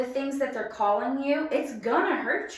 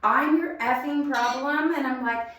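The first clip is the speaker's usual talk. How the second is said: raised, higher mock yell at the husband; retold screaming; 4 semitones higher, 5 dB louder than usual. Wife re-enacting her yell at her leaving husband, loud and high. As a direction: shout it the way she yelled in the driveway. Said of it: "And I'm just screaming at him".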